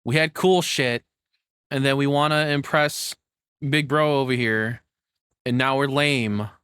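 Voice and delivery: loud, worked-up complaining, high and punchy; heated rant. Real, not acted. Co-host ranting loudly at the West over lost fun. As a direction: vent it hard like a fed-up fan.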